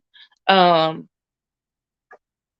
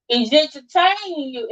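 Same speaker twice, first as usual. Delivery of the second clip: ordinary talk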